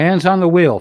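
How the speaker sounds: high, loud command; barked order. Real, not acted. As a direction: snap the order like a driving instructor. Scolding streamer driving while streaming.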